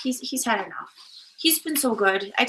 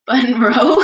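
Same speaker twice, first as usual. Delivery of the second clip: laughing; 2 semitones lower, 9 dB louder than usual. Real, not acted. Laughing at a silly word.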